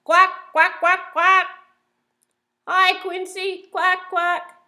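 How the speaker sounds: loud, high squawking duck voice; shouting duck. Acted. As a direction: belt it out like a noisy duck. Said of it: "shouts Dottie".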